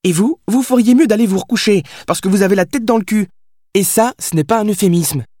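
put-on high-pitched sassy young woman talking back; indignant Billy. A narrator's reading. Billy returns the writer's insult, high and indignant.

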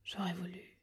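un dernier souffle presque inaudible; les mots meurent sur les lèvres; voix entrecoupée. A narrator's reading. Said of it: "d'une voix entrecoupée". (le frère dit ses derniers mots, à peine audibles)

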